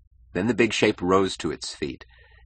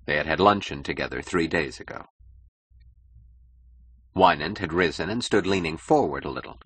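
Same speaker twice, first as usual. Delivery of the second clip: ordinary delivery, nothing marked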